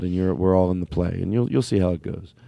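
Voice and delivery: ordinary talk, nothing marked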